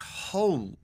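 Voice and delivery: ordinary delivery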